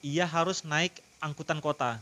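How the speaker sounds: usual speaking voice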